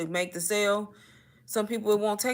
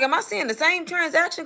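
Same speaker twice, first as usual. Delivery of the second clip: surprised; 9 semitones higher than usual. Retold or imitated. Disbelieving inner thought quoted.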